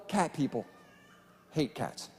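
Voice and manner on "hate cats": deadpan aside. Acted. Deadpan contrast after praising cat people.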